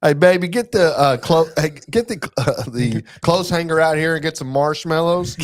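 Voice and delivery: put-on high, loud country-dad accent. Dad calls wife and kids to the fire, country accent. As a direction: holler to the family in a hammed-up country accent. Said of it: "why, why the accent?".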